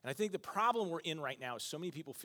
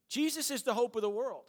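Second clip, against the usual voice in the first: preaching at full force; 7 semitones higher, 5 dB louder than usual. Real, not acted. Repeated proclamation.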